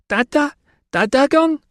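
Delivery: put-on high, loud child's shout. Little child shouting for his father, loud. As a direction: toddler calling out happily. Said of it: "the sound of Lucius shouting".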